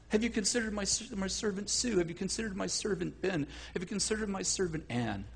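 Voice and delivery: very soft, near-murmured suggestion; sly hushed offering. Acted. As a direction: quietly dangle each name as bait. God slyly offering servants as bait.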